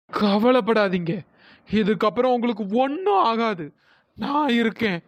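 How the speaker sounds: low, slow, reassuring ghost voice; calm their fears, low and unhurried; solemn reassurance. Acted. Ghost woman promises the searchers protection, solemnly reassuring.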